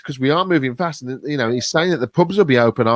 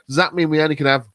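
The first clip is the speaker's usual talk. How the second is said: normal talking voice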